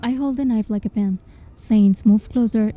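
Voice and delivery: ordinary delivery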